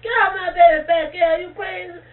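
put-on loud, high cry to pull the child back; alarmed shout. Mama shouting at the girl near the snake, frightened yell. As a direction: yell at her in fright, voice cracking. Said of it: "Mama said".

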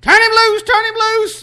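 high-pitched panicked squeal. Retold or imitated. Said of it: "said he squealed like a little girl".

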